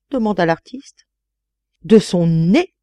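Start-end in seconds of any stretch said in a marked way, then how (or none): 1.8-2.7 louder cry, rising at the end. A narrator's reading. Duchess interjecting to the artist, crying out. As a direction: call it out in exclamation. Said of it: "cria sa grâce".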